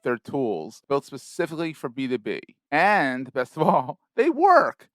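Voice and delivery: upbeat sponsor read, brisk and polished; podcast ad read. Acted. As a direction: sell it like a radio ad.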